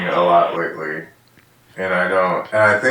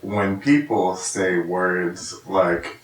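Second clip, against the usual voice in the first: normal talking voice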